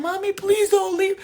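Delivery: imitating her daughter crying as mommy leaves; crying child. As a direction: beg like a little girl clinging to her mother. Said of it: "she'll have my daughter crying".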